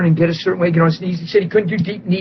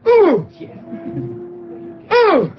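excited whoop; 10 semitones higher than usual. Real, not acted. Preacher exclaiming to the church, a high excited whoop. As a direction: let out a thrilled cry.

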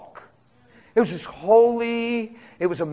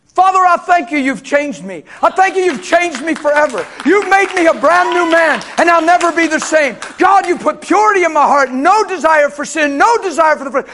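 shouted, higher thanksgiving prayer; loud praise prayer; 6 semitones higher, 6 dB louder than usual. Retold or imitated. Preacher shouting thanks to God in worship, loud and high. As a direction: proclaim it loud and high, full force. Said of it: "I said, out loud, real loud".

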